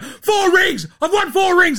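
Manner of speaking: loud, shrieked high boast; hammed-up indignant shouting. Clay insisting on his titles, hammed-up indignant shouting. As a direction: shout over everyone in mock outrage. Acts out Clay yelling again.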